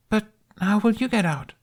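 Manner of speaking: put-on high, anxious young boy; worried child voice. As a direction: ask it like a scared kid.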